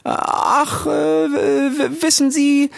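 very high, stammering; flustered Frau Jaki. Acted. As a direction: caught off guard, stumble over the words. (verlegene Frau Jaki, sehr hoch und stotternd)

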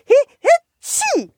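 squeaky high sneeze, drawn out. Acted. Snowman sneezes. (hohes, langgezogenes Niesen)

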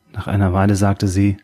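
ordinary delivery

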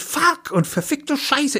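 mock yell. A narrator's reading. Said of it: "brüllt".

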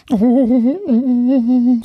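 high, loud, long wailing "oh"; frightened little ghost. Acted. Little ghost hears steps on the stairs, frightened high wail. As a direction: wail it like a scared tiny ghost. Ghost dreads approaching adult.

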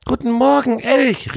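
the squirrel greeting the elk cheerfully; squirrel calling out. A narrator's reading. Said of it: "rief er dann".

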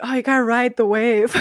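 laughing high surfer-dude quote; mock surfer, laughing through it. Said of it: "everyone's like".

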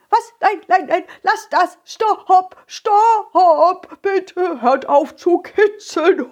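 high giggling pleading; laughing while tickled. Acted. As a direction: beg to stop through laughter. Path tickled begging.

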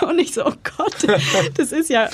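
high, giggling panic over first bookings; breathless giggle at suddenly having her first couples; laughing flustered. Retold or imitated. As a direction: laugh through it, overwhelmed and thrilled. Retold flustered reaction to first bookings.

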